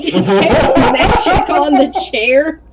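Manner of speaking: loud laughing, slow words. Real, not acted. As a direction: crack up while saying it. Player cracking up at own joke.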